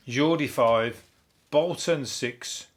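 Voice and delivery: normal talking voice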